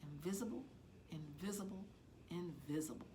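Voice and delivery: hushed, barely voiced chant to herself; repeated whisper of a wish to vanish; silent thought. A narrator's reading. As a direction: breathe it like a private wish, very softly. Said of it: "and think".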